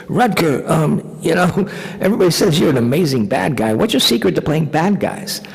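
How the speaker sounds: imitating a nervous teen, pitched high; high, squeaky small talk out of terror. Scared seventeen-year-old makes small talk with intimidating star, squeaky imitation. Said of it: "with my squeaky voice".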